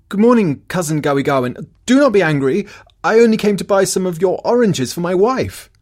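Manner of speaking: loud, high greeting called from afar; calling out. A narrator's reading. Aponitolau calls out to the startled orange keeper, loud and high. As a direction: hail him loudly, friendly and pleading. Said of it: "Aponitolau called to him".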